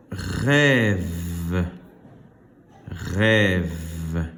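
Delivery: "rêve" stretched with a buzzing V ending; final-sound demonstration. Shown as a demonstration. Teacher having pupils find the last sound, drawn-out "rêve". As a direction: hold the V closing "rêve". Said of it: "Le dernier son".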